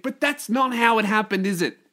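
usual speaking voice

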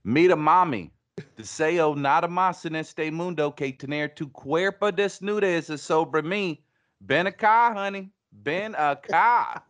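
high, loud come-on in twangy Spanish; hammed-up Southern twang Spanish seduction; Southern-accented Spanish. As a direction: woo her in horribly accented Southern Spanish. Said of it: "the Southern Spanish is making me feel".

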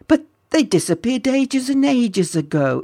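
put-on high, protesting young voice; incredulous lad butting in; interrupting boy. A narrator's reading. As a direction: cut in with a high objection. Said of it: "interrupted the boy".

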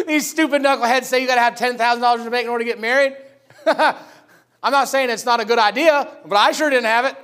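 loud, high ranting through laughter; laughing rant. Real, not acted. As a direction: scoff loudly while cracking up. Laughing at marriage money advice.

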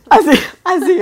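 laughing. Real, not acted. Laughing imitating crying.

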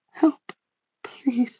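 weak plea that jumps high on please; faltering in pain. A narrator's reading. Hurt brother begging for help, faltering. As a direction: beg through pain, voice cracking upward. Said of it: "I could hear his voice falter in pain".

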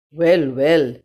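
a lightly voiced gruff bear; gruff polar bear. A narrator's reading. Polar bear greets the lost girl, lightly gruff. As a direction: rumble it out like a big friendly bear. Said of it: "spoke the monster in a gruff voice".

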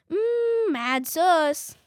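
high, loud, drawn-out teasing "mm"; mock suggestive schoolboy reaction, loud and high; imitating popular boys. Kid imitating popular boys at tech class, high suggestive mm. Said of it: "imitating the popular boys".

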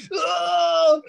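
loud, high imitation of a child's cry of pain; mock howl of agony; agonised wail. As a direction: wail as if it's the worst pain ever. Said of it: "Like the worst pain ever".

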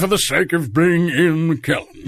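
hammed-up impression of a famous actor; Ian McKellen. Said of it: "if it's perfect for that impression".

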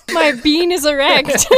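laughing through a crude joke. Acted.

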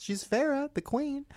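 high, gushing praise for the queen; hyped-up praise. Real, not acted. Hyping Farrah up to her co-host, high and gushing.

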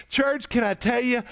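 ordinary delivery

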